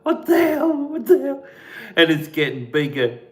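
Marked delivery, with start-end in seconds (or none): 0.0-1.4 high startled cry; loud shocked exclamation; startled what the hell. Retold or imitated. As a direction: blurt it out in alarm. Startled by growing shape.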